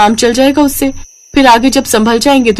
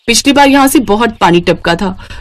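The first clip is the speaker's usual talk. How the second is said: normal talking voice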